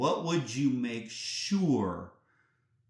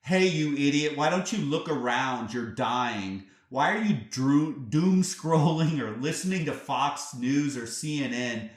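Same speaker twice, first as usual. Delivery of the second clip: loud, raised-pitch rebuke; shouting; 4 semitones higher, 6 dB louder than usual. Acted. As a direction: shout it like a rude wake-up call. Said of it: "shouts, uh".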